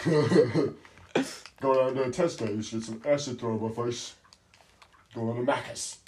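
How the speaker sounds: hammed-up British lad accent; mock British accent. Friend making fun of British people, loud mock British accent. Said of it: "just making fun of British people".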